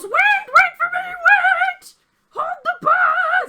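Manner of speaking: very high, loud shout to hold the bus; goblin shouting. Acted. Goblin running late yelling at the driver, high and loud. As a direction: yell after the bus in a squeaky goblin voice. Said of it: "and he goes, shout".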